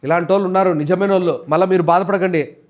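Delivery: ordinary delivery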